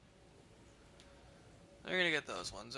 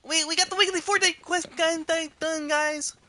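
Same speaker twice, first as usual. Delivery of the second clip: much higher, loud triumphant cheer; excited shout; 13 semitones higher, 7 dB louder than usual. Real, not acted. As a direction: yell it out like you just won. Celebrating finishing the quest.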